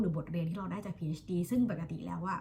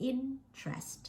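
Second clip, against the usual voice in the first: INtrest, high IN and softer -trest; stress on first syllable; 7 semitones higher, 4 dB quieter, much slower than usual. Shown as a demonstration. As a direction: hit the first syllable of "interest", let the rest drop. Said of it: "stress อยู่ที่พยางค์ที่". (เน้นพยางค์แรกของ interest เสียงสูง แล้วเบาลง)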